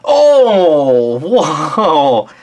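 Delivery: loud high oh whoa; real shock at the roll; surprised outcry. Real, not acted.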